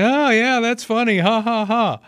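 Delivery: high sarcastic mock laugh; fake-amused TV host; mocking news anchor. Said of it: "It's like".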